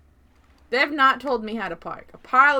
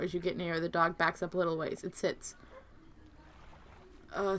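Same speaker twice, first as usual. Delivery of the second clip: soft, quick narration read; reading game text; 5 semitones lower, 5 dB quieter, faster than usual. Acted. Streamer reading the dog scene narration, softly and quickly. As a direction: murmur the story text.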